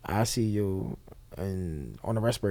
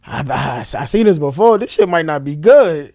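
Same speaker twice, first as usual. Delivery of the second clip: rising alarm; 5 semitones higher, 12 dB louder than usual. Real, not acted. Seeing his brother cry, fearing death.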